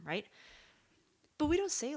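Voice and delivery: normal talking voice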